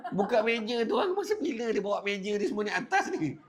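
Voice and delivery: high, laughing disbelief; laughing amazement. Real, not acted. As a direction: marvel at it with a laugh. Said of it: "yang aku paling heran". (hairan sambil ketawa, nada tinggi)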